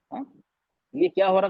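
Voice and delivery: ordinary talk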